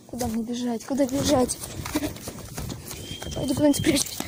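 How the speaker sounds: ordinary talk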